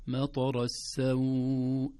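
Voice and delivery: السوء drawn out slowly; long vowel. Shown as a demonstration. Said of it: "هذه هكذا مد".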